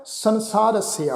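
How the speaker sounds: ਸੰਸਾਰਸਯ said slowly, the last sassa taking sihari and then aira: san-saar-si-ya; slow, falling san-saar-si-ya for ਸੰਸਾਰਸਯ; pronunciation demo. Shown as a demonstration. Teacher showing how to voice the half ya in ਸੰਸਾਰਸਯ, slowly. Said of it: "ਇਸ ਦਾ ਉਚਾਰਨ ਕਰਾਂਗੇ".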